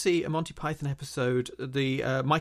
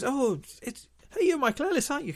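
much higher, eager mock voice; excited recognition; 13 semitones higher than usual. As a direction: squeal it like spotting a celebrity. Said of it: "everywhere he goes".

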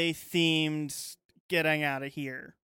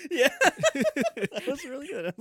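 laughing; 9 semitones higher, 6 dB louder, much slower than usual. Real, not acted. Laughing at a friend's joke.

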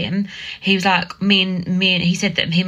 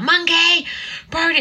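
put-on high calling for dog and child; retold frantic calling; 10 semitones higher than usual. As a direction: shout both names in panic. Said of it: "I'm like".

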